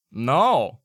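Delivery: loud, flat stone-faced gnome; gnome's blunt no. Acted. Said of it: "the compatriot goes".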